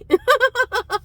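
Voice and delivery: high, loud burst of laughter; delighted laugh. Real, not acted. Telling viewers about buying the book, delighted laugh.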